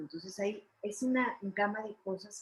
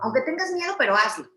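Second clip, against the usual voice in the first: loud rallying slogan; 6 semitones higher, 11 dB louder than usual. Acted. Emphatic motivational slogan.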